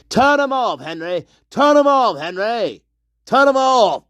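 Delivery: high, loud repeated yell; yelling at Henry. Acted. Ranting character yelling at Henry to turn them off, loud. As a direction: bark the order at him over and over.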